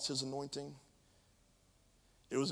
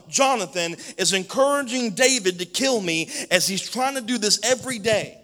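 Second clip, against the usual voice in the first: higher, much louder raging accusation; furious shout; 8 semitones higher, 14 dB louder than usual. Acted. King Saul shouts accusations at his officers, furious. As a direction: rage at the officers like a betrayed king. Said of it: "Saul shouted".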